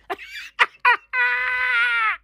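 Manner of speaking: high shrieking laugh at own joke. Real, not acted. Comic shrieking with laughter at his own crude joke.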